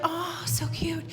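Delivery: soft, slow, whispery aww; tender cooing. Retold or imitated. Audience reacting to the old couple, soft whispery coo. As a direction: coo softly, melting at the sight.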